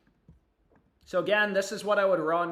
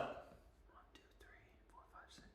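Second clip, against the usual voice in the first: whisper; 25 dB quieter than usual. Real, not acted.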